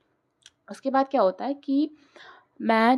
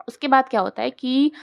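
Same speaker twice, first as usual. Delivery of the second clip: usual speaking voice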